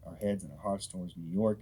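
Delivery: ordinary talk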